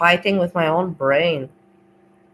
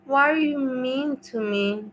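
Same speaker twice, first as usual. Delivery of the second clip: higher, slow hurt whine; whiny Steve voice; 6 semitones higher than usual. Retold or imitated. Narrator mimics friend's hurt voice message, higher slow whine. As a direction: mimic his wounded plea, drawn out. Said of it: "when I heard his voice say to me".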